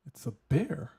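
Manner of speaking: mock shock at spotting a bear; shocked quote. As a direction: blurt it out in disbelief.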